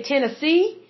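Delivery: Tennessee drawn out, pitch going up on the last syllable; rising end on Tennessee. Retold or imitated. Host imitating Tennesseans, Tennessee with a rising end. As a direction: lift the end of Tennessee like a question. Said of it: "you kinda go up on the end of it".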